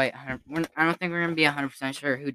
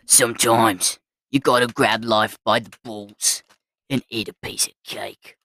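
a bad Australian accent, put on; 5 semitones lower than usual. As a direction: ham up an Aussie bloke. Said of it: "I did it in this exact voice".

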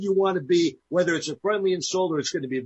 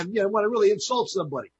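usual speaking voice

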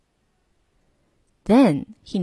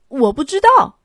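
higher, louder and carefree; cheerful; 5 semitones higher, 5 dB louder than usual. A narrator's reading. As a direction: answer with a happy shrug. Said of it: "巴士司机欢快地回答".

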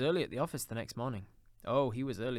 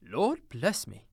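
higher, louder gasped exclamation; astonished cry; 4 semitones higher, 7 dB louder, much slower than usual. A narrator's reading. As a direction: cry out as if breath is taken away. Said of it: "as if his breath were taken away".

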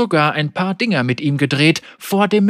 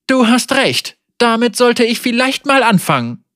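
higher, mock agreement; sarcastic; 7 semitones higher than usual. A narrator's reading. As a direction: agree with false sweetness. Wei fake agreement.

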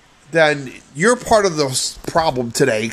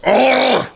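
disgusted groan; 9 semitones higher, 3 dB louder than usual. Real, not acted. Disgust at the word.

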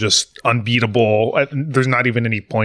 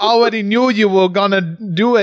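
dramatic GM voice; 8 semitones higher than usual. Pretend. Game master dramatic ruling.

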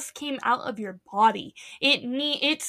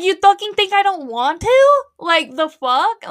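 higher, louder indignant outburst, slowing at the end; voiced angry retort; 6 semitones higher, 7 dB louder than usual. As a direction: snap back at the nurse. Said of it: "she's like".

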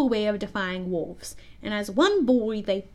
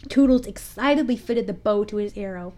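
usual speaking voice

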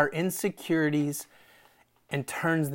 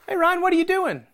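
mock friend's question; 12 semitones higher, 5 dB louder, faster than usual. Friend quizzes the speaker.

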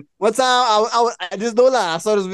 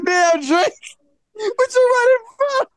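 high taunting call; slower than usual. Retold or imitated. Narrator's own retold taunt.

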